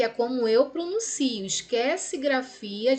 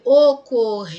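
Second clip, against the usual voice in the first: OCORRIDO with a long, loud RI; stretched stressed syllable; 2 semitones lower, 10 dB louder than usual. Shown as a demonstration. Teacher looks for a polysyllabic paroxytone, stretched stress. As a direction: call out "ocorrido", dragging the RI. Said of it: "Polissílaba e paroxítona".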